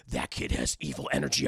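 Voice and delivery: very low, grave whisper-like line; deep ominous voice. Acted. Sassy senses evil.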